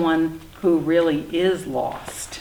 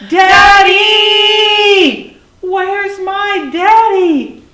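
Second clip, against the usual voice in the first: high, drawn-out storybook call for a parent; yelling; 12 semitones higher, 13 dB louder, much slower than usual. A narrator's reading. Lost little dinosaur yells for his daddy, long high holler. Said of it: "And he yelled".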